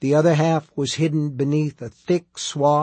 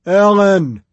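very loud, higher drawn-out call; bawling; 5 semitones higher, 10 dB louder than usual. A narrator's reading. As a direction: bawl a name across the yard. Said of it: "she bawled".

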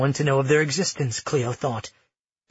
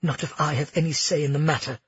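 ordinary delivery, nothing marked

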